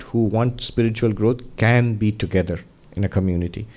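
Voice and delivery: ordinary delivery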